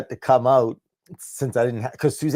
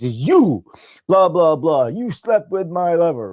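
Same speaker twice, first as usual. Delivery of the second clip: raised, louder mock accusation; hammed-up jealous confrontation; acted angry threat; 6 semitones higher, 5 dB louder, slower than usual. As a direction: play at grabbing him and accusing him.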